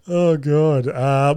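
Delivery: normal talking voice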